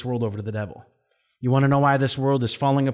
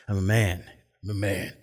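lightly put-on low manly voice; mock macho; 5 semitones lower, 4 dB quieter than usual. Boy refusing to be the crier, mock manly and low. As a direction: drop the pitch for a hint of macho. Mimics his walled-up younger self.

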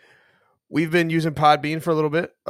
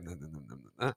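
mumbling imitation; 11 semitones lower, 17 dB quieter, much faster than usual. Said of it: "I can never really understand you sometimes".

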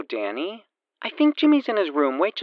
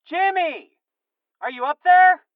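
louder high call upstairs; yelling across the room; 4 dB louder than usual. Acted. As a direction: shout up the stairs. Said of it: "louder this time".

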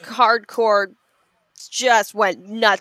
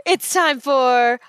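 announcer voice; 3 semitones higher than usual. Acted. Announcing the segment.

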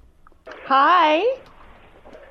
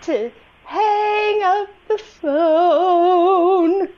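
slow commanding order; much slower than usual. Pretend. Ordering the caller to hang up.

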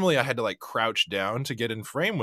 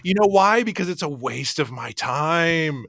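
mock exasperation; 5 semitones higher, 4 dB louder than usual. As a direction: protest loudly, stretching out the complaint. Mock outburst at co-host's questioning.

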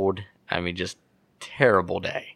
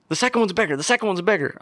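much higher, urgent excited insistence; 11 semitones higher, faster than usual. Retold or imitated. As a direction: pitch leaps way up, urging him to look. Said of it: "He goes".

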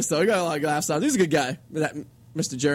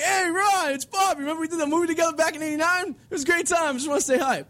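prank caller voice; 9 semitones higher than usual. Retold or imitated. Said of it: "So we'd be like".

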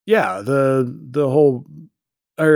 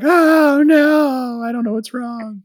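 mock panic; 11 semitones higher, 3 dB louder, slower than usual. Crew panicking, retold.

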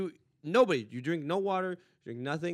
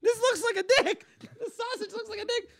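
put-on very high, squealing child; hammed-up little-kid protest; 7 dB louder than usual. Kid objecting to sausage, high mock whine.

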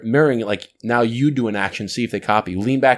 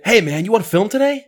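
much higher, lively and friendly; upbeat friendly ask; 9 semitones higher than usual. Shown as a demonstration. Host contrasting an upbeat ask with a flat one. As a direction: sell it with bright energy. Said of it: "it's how you say it".

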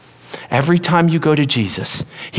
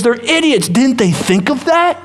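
mocking critic; 6 semitones higher than usual. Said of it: "the crowd that says".

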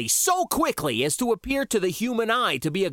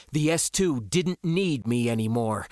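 usual speaking voice

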